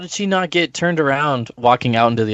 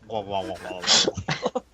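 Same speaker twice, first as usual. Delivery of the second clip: mock crying; 8 semitones lower, 9 dB quieter, faster than usual.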